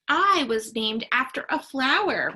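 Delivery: ordinary delivery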